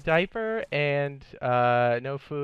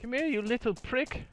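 high taunting voice; 10 semitones higher, 5 dB quieter than usual. Acted. Chasing enemy in voice.

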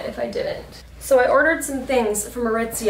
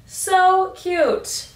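gushing; 9 semitones higher, 5 dB louder than usual. Real, not acted. Gushing over cropped sweatshirt.

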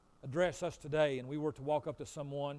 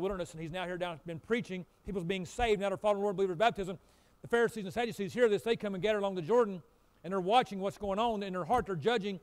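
louder, driving sermon storytelling; lively preaching; 5 semitones higher, 8 dB louder than usual. Real, not acted. Pastor retelling John's ministry, rising preaching. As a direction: build the story from the pulpit.

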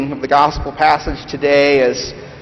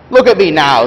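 loud, higher, aggrieved working man; bitter ghost; 3 semitones higher, 6 dB louder than usual. Acted. Big Ghost accusing the bright Spirit, loud and indignant. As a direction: bluster like a wronged, bitter ghost.

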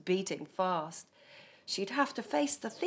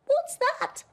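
frightened squirrel; 7 dB louder than usual. Acted. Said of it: "she whispered to her dad".

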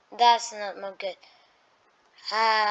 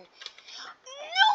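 high wailing no; anguished no. Real, not acted. Gamer wailing as the enemy overwhelms him. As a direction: cry out in dismay.

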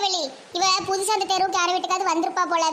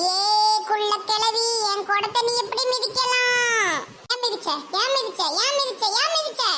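furious tearful demand climbing in pitch; angry crying rant; 3 semitones higher than usual. Acted. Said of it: "எனக்கு கோபம் வந்து தலைக்கு ஏறிட்டு".

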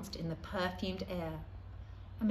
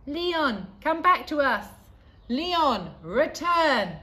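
higher, louder echoing call; calling from afar; 7 semitones higher, 9 dB louder, slower than usual. A narrator's reading. Voices call Leon back home, echoing calls. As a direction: call his name as if from far away.

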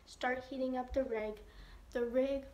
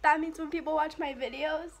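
louder, higher, gleeful; excited; 4 semitones higher, 4 dB louder than usual. Real, not acted. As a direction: gloat about getting paid. Excited remonetization.

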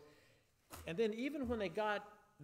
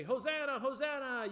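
louder, higher call of Hosanna; demo cheer; 8 semitones higher, 4 dB louder than usual. Shown as a demonstration. Leader showing the kids at home how to cheer, loudly. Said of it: "You can say".

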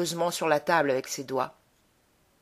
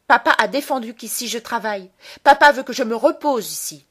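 put-on louder, higher, defiant child's retort; proud defiance; 4 semitones higher, 7 dB louder than usual. A narrator's reading. Child invokes his father's authority, proud and defiant. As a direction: throw it out proudly, like a threat. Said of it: "lança-t-il avec toute la fierté".

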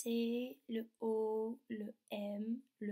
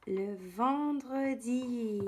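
stretched, louder "vendredi"; drawn-out word; 5 dB louder, much slower than usual. Shown as a demonstration. Teacher announcing the day to children, slowly stretched. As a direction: draw out each syllable for young writers.

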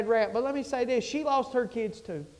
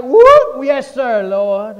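mock startled yelp, then a quick yes sir; startled jump; 4 semitones higher, 16 dB louder, much slower than usual. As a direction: jolt up like you just heard God call. Acting out a startled listener.

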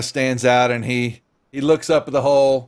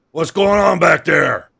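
gruff voice; 4 semitones higher, 4 dB louder than usual. Acted. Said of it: "you hear a gruff voice".

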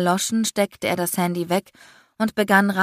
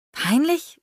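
puzzled; 10 semitones higher than usual. A narrator's reading. Puzzled girlfriend.

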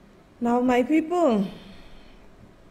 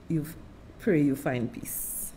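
hushed repeat; 6 semitones lower, 7 dB quieter than usual. Real, not acted. Host praying for the leader, softer and lower. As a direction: let it sink to a hush.

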